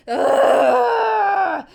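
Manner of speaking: loud disgusted ugh; groan of disgust. Real, not acted. Reacting to Fyodor calling Aya princess, a loud disgusted groan. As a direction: let out a loud fed-up groan.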